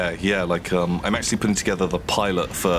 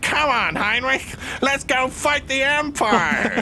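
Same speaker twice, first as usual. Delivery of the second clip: Krell voice; slower than usual. Acted. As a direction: bellow like a thrilled dim-witted undead lug. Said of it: "that's more of the Krell voice".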